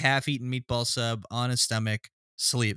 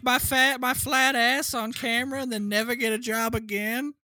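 put-on high, eager young actress voice; naive actress; 8 semitones higher than usual. Mocking the actress, who agrees in a high eager voice. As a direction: chirp it high like a clueless starlet.

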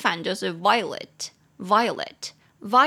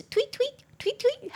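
bird tweeting; slower than usual. Shown as a demonstration. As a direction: chirp it like a small bird. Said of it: "小鸟叫的那个声音，就是".

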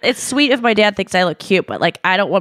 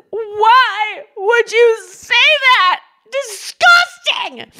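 slow, much higher shriek of disgust; teen disgust yell; 3 dB louder, much slower than usual. Retold or imitated. Her teen self yelling at dad over soup, shrill disgust. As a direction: shriek it like a horrified teenager. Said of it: "And I said".

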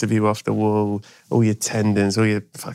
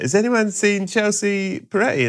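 high calling voice; 10 semitones higher than usual. Retold or imitated. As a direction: ask the whole room. Calling out to the gym.

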